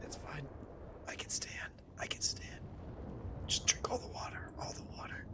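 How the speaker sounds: breathless pained whisper. Acted. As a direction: whisper it, hurt and weak. Wounded character whispering in tavern.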